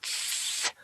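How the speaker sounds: long soft "tss"; mock construction-worker hiss; catcall hiss. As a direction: hiss to get a passer-by's attention. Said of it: "construction worker's like".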